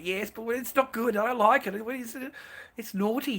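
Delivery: high, mocking imitation of a flustered woman; mock girlfriend freaking out. Retelling his partner's reaction, a high mock protest. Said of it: "She used to freak, absolutely freak out".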